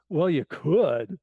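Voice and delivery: slow, drawn-out, dismissive "Well, you could"; condescending doctor. Retold or imitated. Doctor brushing off a patient's wish, slow and condescending. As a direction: let it drip with doubt, as if only a fool would. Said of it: "with exactly the right intonation".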